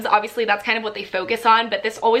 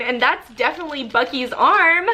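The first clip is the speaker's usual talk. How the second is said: normal talking voice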